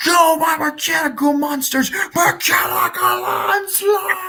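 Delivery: high, slow, shouted attack name; anime attack shout. Acted. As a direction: roar the attack like a duelist.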